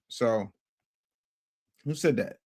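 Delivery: ordinary talk, nothing marked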